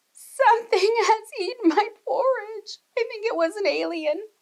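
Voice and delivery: a tiny cub, sad but giggly; sad little bear. A narrator's reading. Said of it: "looked sadly".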